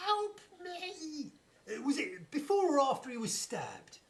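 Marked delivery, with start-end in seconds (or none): 0.1-1.3 mock plea. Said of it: "he said".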